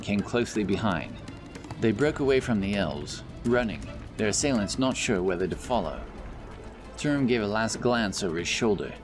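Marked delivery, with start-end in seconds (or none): none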